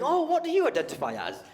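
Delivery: high mocking question, hammed up; mock bigot voice. Comic mocking a bigot asking. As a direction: sneer it like a bigot.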